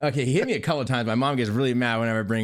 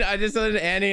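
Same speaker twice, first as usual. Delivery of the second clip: higher, giggling through the confession; laughing while talking; 6 semitones higher, much faster than usual. Real, not acted. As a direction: try to speak but the laugh keeps breaking in. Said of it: "I'm doing it right now".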